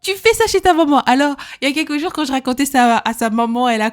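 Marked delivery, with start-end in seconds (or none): 0.0-1.0 much higher, sing-song mock agreement; wife teasing husband about his mother, high; teasing retort. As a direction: tease him with high mock yeses. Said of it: "J'ai dit".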